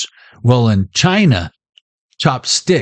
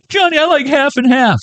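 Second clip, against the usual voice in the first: high mock customer voice; 13 semitones higher than usual. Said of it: "quoting a customer, high voice".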